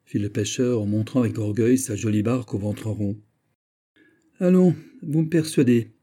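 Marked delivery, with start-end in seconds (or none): 4.4-5.9 higher, louder eager exclamation; exclaiming. A narrator's reading. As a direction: burst out, won over. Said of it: "s'écria monsieur Agnan".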